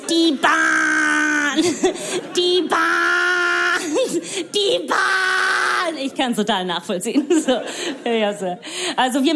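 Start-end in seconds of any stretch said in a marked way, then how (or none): 0.1-6.0 high, long-drawn mock wail; grumbling Germans. Comedian mimicking Germans moaning about trains, drawn-out high wail. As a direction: stretch each word into a strained complaining cry. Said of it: "Ihr meckert und meckert und meckert".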